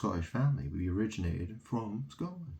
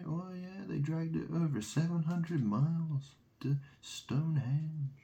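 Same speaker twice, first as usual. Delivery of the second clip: higher, exaggerated gasping wonder; mock news voice; 7 semitones higher than usual. Mocking news reports about Stonehenge, higher mock amazement. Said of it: "They're like".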